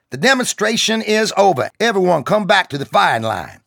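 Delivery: high, loud sergeant's call. Acted. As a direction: call it out to the whole range. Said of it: "he called out".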